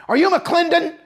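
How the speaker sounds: loud, high barked question; drill sergeant bark. Retold or imitated. Drill sergeant barking at arrival.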